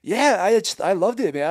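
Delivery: bursting with enthusiasm; excited. Real, not acted. Gushing about the film.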